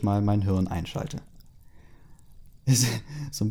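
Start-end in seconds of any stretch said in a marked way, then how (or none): none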